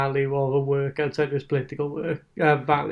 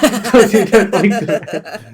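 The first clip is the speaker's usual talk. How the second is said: laughing, slow, much louder and higher; mock disapproval; 6 semitones higher, 10 dB louder, much slower than usual. Mocking Sacha Baron Cohen's moral stands, laughing slow mock outrage.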